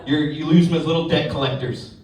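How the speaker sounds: usual speaking voice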